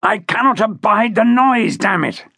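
high-pitched irritated complaint; a touch of harsh, dry-throated anger; angry growl. A narrator's reading. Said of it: "the harsh growl of an angry man".

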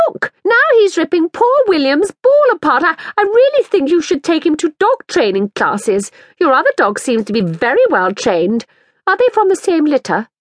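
she's had enough of this dog; lightly voiced exasperated mother. Woman character's voice.

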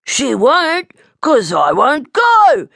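put-on high, loud, slow childish defiance; defiant little bear; bold. A narrator's reading. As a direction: refuse boldly, stretching the words. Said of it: "Blinky boldly replied".

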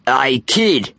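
mildly menacing greeting; unpleasant. A narrator's reading. Bully greeting the new kid, mildly unpleasant. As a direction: greet with false friendliness. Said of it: "smiling unpleasantly".

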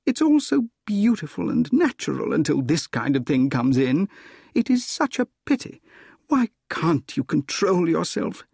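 high-pitched woman's voice, lamenting; acted heroine's reproach; Gladys, reproachful.

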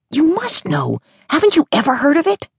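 put-on high, fast pleading child; little girl voice. A narrator's reading. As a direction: beg like an eager six-year-old.

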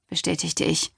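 ordinary delivery

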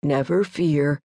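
a big, unhurried eater shrugging it off; glutton voice. A narrator's reading. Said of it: "said the glutton".

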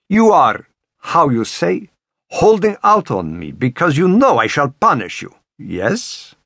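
put-on menacing Russian-accented scolding, starting high. A narrator's reading. Woman promising her captive punishment, Russian accent. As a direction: threaten in a Russian accent, sinking on the last word. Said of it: "tinted with the seductive Russian accent".

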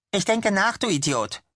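irritated snap. Acted. Tammy snaps at clingy Chad, high and irritated. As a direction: shove him off with your voice.

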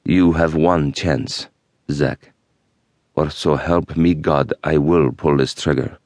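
put-on low, slow, resonant Middle Eastern accent; threatening Middle Eastern accent. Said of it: "the resonant tone of his voice making him all the more threatening".

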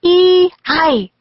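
high, loud yell; ninja battle cry. A narrator's reading. Tall ninja spotting the children, high battle cry. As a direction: cry out like an attacking warrior. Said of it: "the ninja cried".

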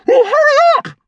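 put-on very high, loud boy's yell; urgent shout. A narrator's reading. Brian hurrying his brother in the toilet, urgent shout. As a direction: yell impatiently at top pitch.

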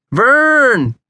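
high, loud holler to wake someone; loud, drawn-out yell of a name. A narrator's reading. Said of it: "Josh finally hollered his name".

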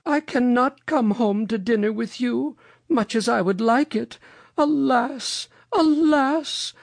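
high-pitched, lamenting storybook lady; Mother Goose. A narrator's reading. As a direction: lament it high, drawing out each alas. Mother Goose declines dinner.

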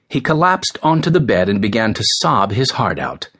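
plain reading voice

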